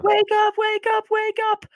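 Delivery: put-on little girl waking the band by screaming. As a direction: shriek it like a hyper kid. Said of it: "she just screamed".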